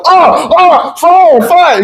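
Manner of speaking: very high, hammed-up panic; pretending to be on fire. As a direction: fake frantic alarm. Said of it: "you pretend to be on fire".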